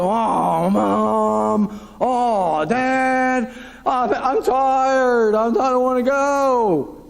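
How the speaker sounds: put-on whining child dragging out each word, slowly; whiny kids. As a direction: play a tired kid begging not to go, a touch of a sob on Mom. Kids whining about going to church.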